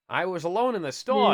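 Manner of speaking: playful character read; comic storekeeper voice. Acted. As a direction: recount it in a silly put-on voice. Voices storekeeper's account in funny voice.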